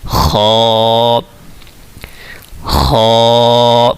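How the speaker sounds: خو scraped at the top of the throat; from the upper throat. Shown as a demonstration. Teacher demonstrates خو for students, upper throat. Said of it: "گلے کی اوپر والی side سے ہم نے اس کو پڑھنا ہے".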